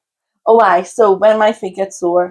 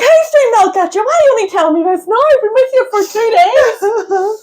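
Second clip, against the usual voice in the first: high mock exasperation; 14 semitones higher than usual. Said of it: "I was like".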